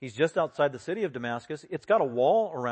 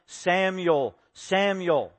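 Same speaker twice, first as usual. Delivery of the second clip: "Samuel" called twice, higher and falling; emphatic call; 4 semitones higher, 4 dB louder, much slower than usual. Retold or imitated. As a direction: summon "Samuel" firmly, drawing out each call. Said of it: "So it is emphatic".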